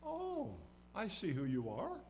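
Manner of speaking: high exclamation of recognition; surprised accusation. Acted. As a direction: catch him out, surprised. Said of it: "and she said".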